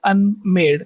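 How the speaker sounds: "unmade" split into un and made; syllables separated. Shown as a demonstration. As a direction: demonstrate "unmade" with un standing apart. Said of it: "ऐसे बोला जाएगा". ("unmade" में un अलग, made अलग)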